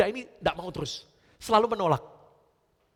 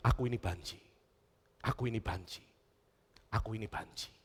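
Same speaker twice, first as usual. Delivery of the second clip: quiet inner voice; 7 semitones lower, 8 dB quieter than usual. Acted. Boy's inner voice, repeated.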